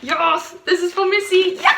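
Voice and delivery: high, loud delight; excited cheer. Real, not acted. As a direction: cheer it, climbing to a squealed "Yeah!". Delighted by gift for Missy.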